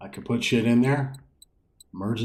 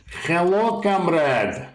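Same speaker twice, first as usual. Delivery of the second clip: yelling across the room; 8 semitones higher, much slower than usual. Real, not acted. Calling out to unseen player.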